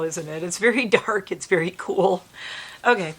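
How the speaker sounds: ordinary talk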